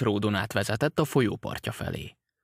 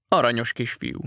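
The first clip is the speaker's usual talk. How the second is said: ordinary delivery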